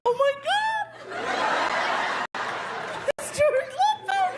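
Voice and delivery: very high, squealed panic; starstruck panic. Acted. Comic spotting the star he joked about, high panicked squeal. Startled at seeing George Lopez arrive.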